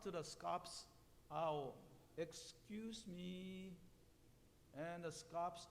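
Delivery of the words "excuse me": soft, very slow, drawn-out call; raspy call. A narrator's reading. Bluebird calls out to the owl, soft drawn-out raspy voice. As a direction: stretch it out softly and timidly. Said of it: "In a raspy voice, the bluebird called out".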